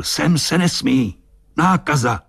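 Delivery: high, drawn-out warning call. A narrator's reading. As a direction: call a warning across the water. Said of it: "a zavolal". (varovné volání vysokým hlasem)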